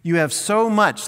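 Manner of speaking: a hint of a divine rebuke; God rebuking. God scolding the rich man, mildly stern. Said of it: "But God said to him".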